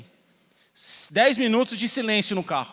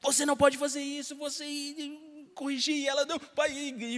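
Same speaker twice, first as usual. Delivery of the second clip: put-on high, breaking little-girl voice, stuttering; stammering child; 8 semitones higher, 5 dB quieter than usual. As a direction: imitate a scared child stammering through sobs. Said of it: "virou um gaguejo".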